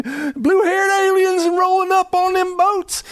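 very high, drawn-out Southern holler; silly hammed-up Southern accent; comic Southern yokel, slow and high. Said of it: "I use a Southern accent".

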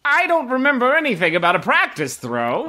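high-pitched feigned ignorance; enticing game vendor. Acted. Vendor denying the practice throw, high barker's voice. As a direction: play innocent in a high hawker's voice. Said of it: "one particularly enticing vendor who's".

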